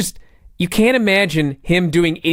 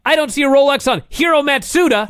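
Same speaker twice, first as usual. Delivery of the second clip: lightly imitated announcer, higher pitched; announcer's pointed interjection; 9 semitones higher than usual. Co-host retells the announcers' comeback about the Rolex, lightly imitated high call-out. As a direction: call it out high, like a commentator chiming in. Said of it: "the other announcers point out".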